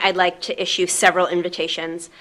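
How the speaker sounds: ordinary talk, nothing marked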